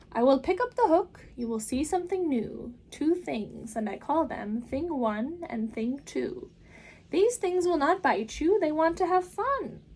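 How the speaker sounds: put-on the Cat in the Hat calming the children, big and upbeat. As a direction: reassure the kids with a grand flourish. Cat presents Things.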